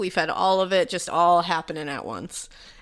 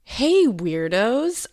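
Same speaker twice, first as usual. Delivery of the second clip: drawn-out greeting; 3 semitones higher, 4 dB louder, much slower than usual. Real, not acted. Signature show greeting drawn out.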